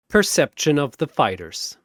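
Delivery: usual speaking voice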